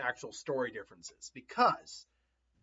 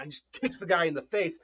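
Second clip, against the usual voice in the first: ordinary delivery, nothing marked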